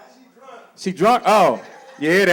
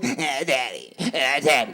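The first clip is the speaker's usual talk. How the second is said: lower, mock whining kid; whiny soft son; 5 semitones lower, 6 dB quieter, slower than usual. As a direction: imitate a spoiled whining boy lightly. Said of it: "That's how it sounded to me".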